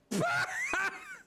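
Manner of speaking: sudden high laugh of relief. Real, not acted. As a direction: burst out laughing at the discovery. Excited laugh at finding arrow.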